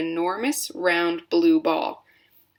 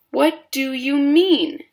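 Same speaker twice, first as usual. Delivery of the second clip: high, slow, indignant question; puzzled girl; 8 semitones higher, 6 dB louder, slower than usual. A narrator's reading. Violet questions her parents, high and slow. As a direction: push back, baffled. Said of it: "said Violet".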